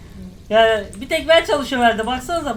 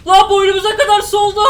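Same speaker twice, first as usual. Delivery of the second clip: higher, loud panicked yell; panicked shout; 6 semitones higher, 6 dB louder than usual. Real, not acted. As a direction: yell as the water rises. Water rising in game.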